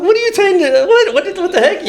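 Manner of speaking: high-pitched, stammering outrage; retold indignation. Player answering words from the bench, high indignant stammer. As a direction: fire back in high, sputtering protest. Retells arguing with teammates on bench.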